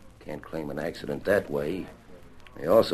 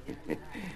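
short mocking laugh; 13 semitones higher, 13 dB quieter than usual. Sneering at Phil's plan.

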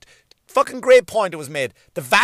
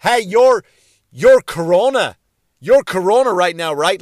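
loud, raised-pitch heckler's shout; mock yelling; 3 semitones higher, 8 dB louder than usual. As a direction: imitate an idiot hollering an accusation across the street. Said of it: "when someone yells at a Chinese person".

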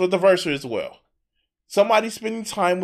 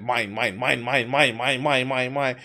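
low possessive whining chant; 5 semitones lower, 4 dB quieter than usual. Retold or imitated. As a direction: whine mine like a greedy child. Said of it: "You wanna be like".

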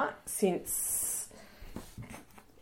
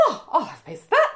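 high, quick imitation of mum; light high mum voice, eager; mum's voice; 10 semitones higher, faster than usual. Imitating mum asking for cake, high and quick. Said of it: "She goes".

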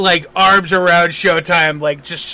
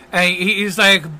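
normal talking voice

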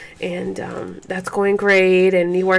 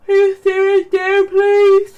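high pleading; 12 semitones higher, 4 dB louder, slower than usual. Real, not acted. Mother asks baby to stay right there, pleading.

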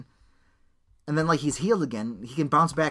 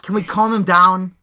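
exasperated plea; 4 semitones higher, 9 dB louder than usual. Real, not acted. Repeated plea, loud and slow.

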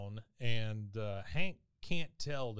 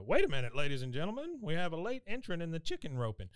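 quick, higher mock announcer; rodeo announcer; 6 semitones higher, 4 dB louder than usual. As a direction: hype up the late entrant, announcer style. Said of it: "and the announcer says".

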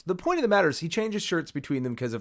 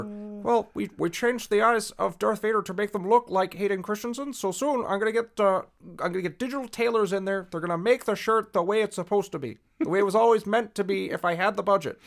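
higher-pitched impression of a film director justifying his tweaks; George; 8 semitones higher than usual. Host as director defending special editions, high impression voice. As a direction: put on a high voice, earnestly explaining plans. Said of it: "it's like he's here in the room".

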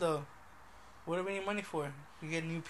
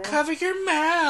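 loud, high, drawn-out mock yell; mock ranting Karen; 10 semitones higher, 10 dB louder than usual. Host acting out friend's rant at Walmart, mock outraged. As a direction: holler it like an outraged shopper. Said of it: "go on a racist rant".

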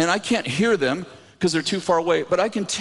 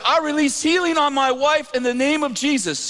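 raised, declarative prayer voice; prayer prompt; 8 semitones higher than usual. Retold or imitated. Preacher leading reluctant husband in prayer, raised declaration. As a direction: proclaim it high for someone to repeat.

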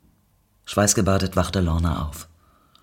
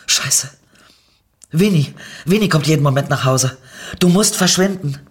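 sudden alarm, louder and higher, rushing; panicked push to get her out before the husband arrives; panicked urging; 6 semitones higher, 6 dB louder than usual. Acted. Panicked, urging Nicky to leave.

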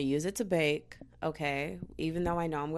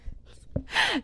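breathy giggle. Real, not acted. Laughing at own accent.

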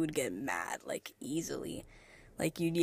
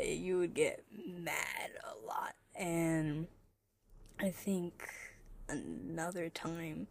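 soft aside; 11 dB quieter, slower than usual. Real, not acted. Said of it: "laughing aside fades".

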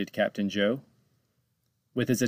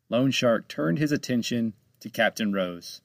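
plain reading voice

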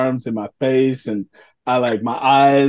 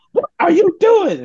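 high, loud shout of an angry mom; furious mom; 5 dB louder than usual. Retold or imitated. Angry mom shouting at coach for stopping the match. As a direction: yell at the coach like a furious mother.

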